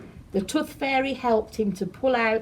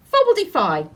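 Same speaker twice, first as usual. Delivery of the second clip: magic spell; 6 semitones higher, 7 dB louder, faster than usual. Acted. Fairy casts magic spell.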